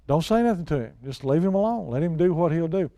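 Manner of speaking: usual speaking voice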